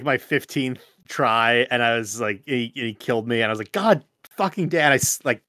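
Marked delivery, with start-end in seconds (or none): none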